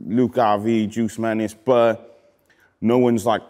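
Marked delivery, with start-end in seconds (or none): none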